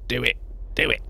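lightly voiced Palpatine, coaxing; a hint of the Emperor egging on; Palpatine impression. Mocking a friend who urged a dungeon run, as Palpatine coaxing. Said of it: "Palpatine in the Star Wars prequels".

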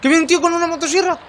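high, loud alarmed cry; panicked shout. Real, not acted. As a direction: yell it as it charges. Chainsaw enemy charging at them.

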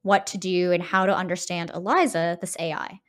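ordinary delivery

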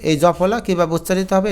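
ordinary delivery

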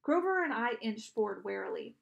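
plain reading voice

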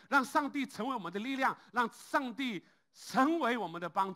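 loud, high preaching cry; full-force preaching. Acted. Pastor exhorting the congregation to rely on God, full-force preaching. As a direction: drive the call home to the church.

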